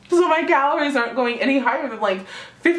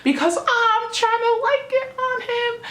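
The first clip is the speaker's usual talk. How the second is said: sassy high drawl; 11 semitones higher, slower than usual. Retold or imitated. Telling off a rival girl.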